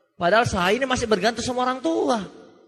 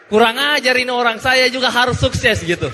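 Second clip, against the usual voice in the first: loud, indignant retold outburst; retold annoyance; 6 dB louder than usual. Speaker resolving to succeed after feeling trampled, retold irritation. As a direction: vow it loudly, as if insulted. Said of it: "Langsung jengkel banget saya".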